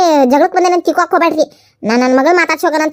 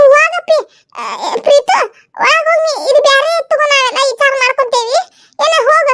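higher, nervous laughing woman; higher voice, flustered and laughing; 10 semitones higher than usual. Acted. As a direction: caught off guard, stammering in a high voice. High character shooing daughter away.

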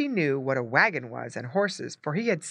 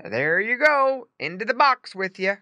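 raised, louder farmer's call; crying out; 6 semitones higher, 4 dB louder than usual. A narrator's reading. Farmer putting Squinty in the box, crying out. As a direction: call it out as you drop him in. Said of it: "cried the farmer".

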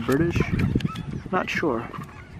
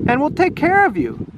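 much higher, eager comforting; kind girl voice; 10 semitones higher than usual. Acted. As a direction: Mimi reassures the dragon, high and eager. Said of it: "said Mimi".

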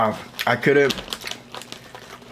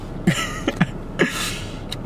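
high breathy laugh; 6 dB quieter than usual. Real, not acted. Laughing after retold yell.

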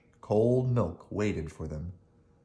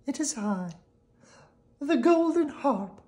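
soft harp voice. Acted. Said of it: "said the soft voice".

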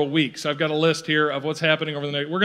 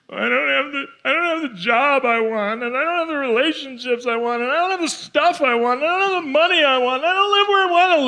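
whiny complainer; 6 semitones higher than usual. Retold or imitated. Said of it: "list of reasons to be negative".

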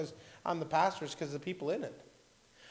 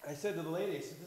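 ordinary talk, nothing marked

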